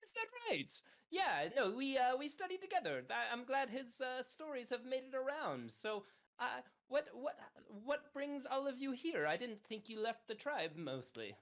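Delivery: high, eager, rushing fairy voice; excited high character voice. Acted. Hubert excitedly greeting the ox at the front desk, high and eager. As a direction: gush over meeting a fellow tribesman.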